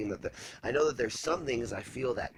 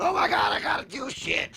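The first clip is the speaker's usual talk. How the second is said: high, loud panicky outburst; mock freak-out; 8 dB louder than usual. Host retelling their own panic to a calm friend, hammed-up freak-out. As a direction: act out yourself freaking out, voice shooting up. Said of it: "When I'm freaking out, going".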